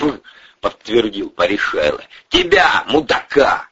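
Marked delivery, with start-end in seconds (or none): 2.3-3.7 high, exasperated jab; angry insult. Acted. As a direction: hurl the insult at the culprit. Boss confirms with an insult.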